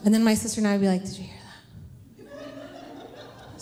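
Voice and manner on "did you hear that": very soft, mock-spooked whisper; hushed children's whisper. Child to sister in bed, whispering. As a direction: whisper it as if someone might hear. Scared children at night, retold.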